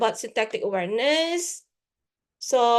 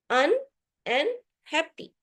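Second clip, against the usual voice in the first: un, and, happy, each part said apart with pauses; word split into parts; 5 semitones higher than usual. Shown as a demonstration. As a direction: break "un" off from "happy", pausing between them. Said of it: "can be broken down into".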